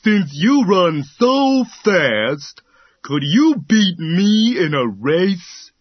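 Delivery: high, slow, patient tortoise. A narrator's reading. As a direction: ask it gently and slowly. Tortoise asks slowly.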